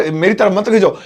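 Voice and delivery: ordinary delivery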